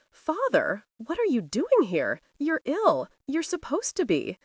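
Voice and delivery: confused protest that rises and stops short; speechless confusion. A narrator's reading. Son finds his supposedly ill father up and about, confused. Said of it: "broke off in speechless confusion".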